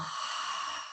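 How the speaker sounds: quiet unvoiced sigh out; just breathe it all out softly; soft breathy sigh. Shown as a demonstration. Guide exhaling with the listener, soft quiet sigh. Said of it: "And as you exhale".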